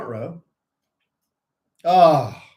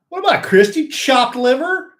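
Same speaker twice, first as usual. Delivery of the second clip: loud, high mock protest; mock indignation; 10 semitones higher, 10 dB louder than usual. Host teasing co-host over a skipped hello, mock indignation. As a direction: protest it big and high, stretching the end.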